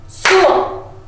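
high, loud one-beat School; chanting School with one clap; clapped word. Shown as a demonstration. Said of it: "let's clap and say school".

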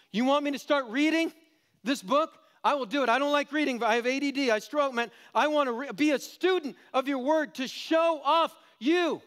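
high, loud hammed-up reluctant convert; mock believer. Preacher mocking a reluctant convert, hammed up. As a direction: play the distracted guy promising to read.

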